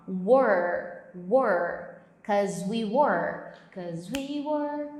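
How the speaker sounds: were repeated as rounded "uór"; pronunciation drill. Shown as a demonstration. Teacher drilling were for students, slow and rounded. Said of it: "descomplica pra você a pronúncia assim".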